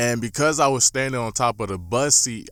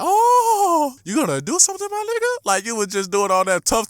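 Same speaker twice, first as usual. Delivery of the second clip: high, loud teasing hype; much higher, loud mocking call; mocking taunt; 5 dB louder than usual. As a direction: egg him on like the whole bus is watching. Kids taunting the boasting kid.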